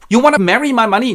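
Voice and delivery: high, loud, rapid question; outraged disbelief. Acted. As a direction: blurt it out, scandalized. Miser misunderstands suitor.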